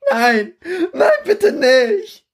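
begging for his life in terror; terrified scream. Acted. Young German begging the recruit for mercy, sobbing scream. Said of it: "screams the kid at you".

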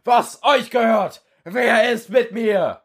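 high, slow rallying speech to a cheering hall; rousing recruiter. Acted. Recruiter firing up recruits in a hall, high and slow. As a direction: stir up the crowd like a war recruiter.

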